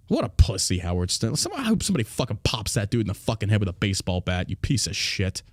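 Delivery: ordinary delivery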